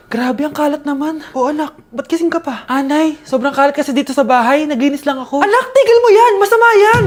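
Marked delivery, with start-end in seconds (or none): 5.4-7.0 alarmed mother scolding. Acted. Mother stops son sweeping at night.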